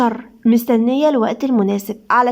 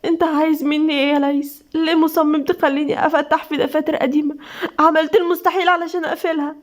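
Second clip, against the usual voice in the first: higher, strained, weepy plea; tearful; 5 semitones higher than usual. A narrator's reading. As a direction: plead weakly through tears. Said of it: "بدموع وهمس". (بدموع وانكسار)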